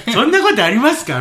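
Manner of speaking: high mock-sympathetic question; annoying fake sympathy. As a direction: play the coworker's smug concern. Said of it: "イライラするでしょ".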